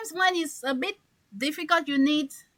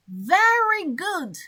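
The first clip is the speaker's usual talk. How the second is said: Very drawn out slowly, a bit higher; stress on very; 6 semitones higher, 3 dB louder, slower than usual. Shown as a demonstration. Teacher showing students how very emphasizes. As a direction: savour the word Very. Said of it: "This word is to emphasize the thing".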